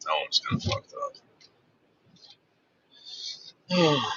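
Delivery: softly whispered under the breath; real hushed aside to the audience. Real, not acted. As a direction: let it slip out as a secret. Muttered aside.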